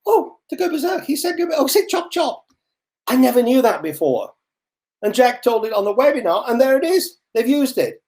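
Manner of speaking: high, excited voice of a surprised listener; mock amazed discovery; imagined listener's surprise. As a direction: squeal it like you just spotted it everywhere. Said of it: "and you're going to say".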